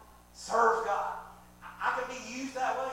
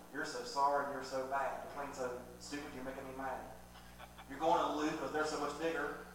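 lower, softer mock taunt; mean cheerleader; 7 semitones lower, 5 dB quieter than usual. Bad cheerleading team mocking the player, lightly voiced lower taunts. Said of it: "they're going".

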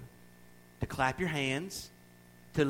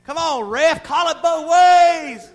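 high, loud, slow shout; a fan shouting at the referee; yelling at the ref; 13 semitones higher, 15 dB louder, much slower than usual. Retold or imitated. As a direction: holler at the ref from the stands. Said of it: "And I yell, and I holler".

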